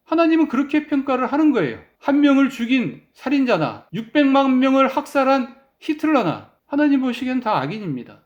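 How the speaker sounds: normal talking voice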